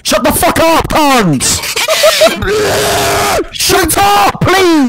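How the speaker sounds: yelling over the noise, high and loud; furious at the screaming friends, real yell; angry shout. Real, not acted. Annoyed streamer yells at noisy friends, shouting.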